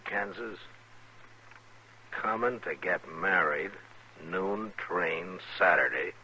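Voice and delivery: quiet, slow, deliberate reading; reading a telegram. Real, not acted. Marshal reading the telegram to friends, quiet and slow. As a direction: read each line like puzzling over it.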